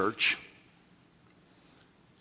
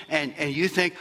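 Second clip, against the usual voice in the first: ordinary delivery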